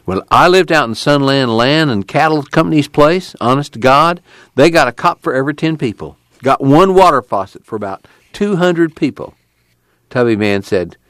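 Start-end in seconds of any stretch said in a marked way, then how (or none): 0.0-9.3 higher-pitched Okie voice with a grievance; aggrieved camp boy. Acted. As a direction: complain earnestly in a raised rural voice.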